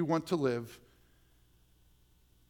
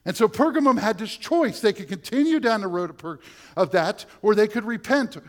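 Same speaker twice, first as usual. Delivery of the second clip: higher, louder fervent preaching; full-voiced revival preaching; preaching at full force; 8 semitones higher, 8 dB louder than usual. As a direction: drive it home to the congregation.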